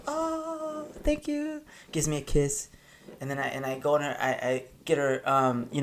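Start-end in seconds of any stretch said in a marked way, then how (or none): 0.1-1.6 high, soft imitation of a pleased girl; girl's thanks. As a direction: breathe it out like a charmed girl. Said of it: "She goes".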